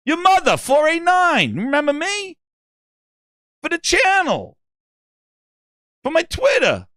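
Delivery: high, hammed-up pestering voice; pushy gamertag fan. Fan pesters host to remember him.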